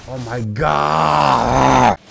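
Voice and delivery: drawn-out loud yell of disbelief; frustrated shout. Real, not acted. Gamer yelling at a tough enemy, drawn-out shout. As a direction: let it rip slow and loud.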